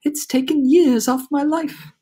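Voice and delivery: high, slow storybook lament; dramatic ladybug complaint; ladybug character. A narrator's reading. Ladybug complains after frightening trip.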